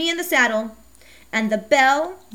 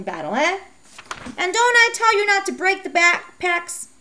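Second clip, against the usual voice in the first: scolding mother; 5 semitones higher than usual. Acted. Mom scolding Becky for wanting a bike, high and exasperated. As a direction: play a sharp, fed-up mother.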